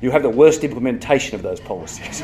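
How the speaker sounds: usual speaking voice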